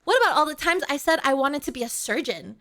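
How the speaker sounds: loud, high-pitched mock outrage; exasperated, shouted complaint; indignant rant. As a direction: let them have it, piling up grievances at full volume. Mock quarrel with chat, bone to pick.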